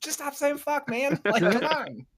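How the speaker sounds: high, amused, still laughing; cheering the kid on through giggles; high, delighted cheer. Retold or imitated. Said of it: "I was like".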